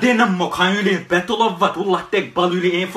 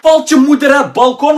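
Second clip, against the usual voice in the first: shout; 5 semitones higher, 7 dB louder than usual. Acted. Yelling back from room.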